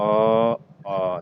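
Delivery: loud, voiced अ; loud vowel. Shown as a demonstration. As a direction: call out अ loud and full. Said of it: "तो ये दोनों ज़्यादा होता है आ".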